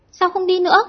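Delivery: sudden high, loud question; blurted out. A narrator's reading. Cúc Hương blurts at the poet who stopped, high and sudden. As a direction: let it pop out before thinking. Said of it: "bất giác vọt miệng".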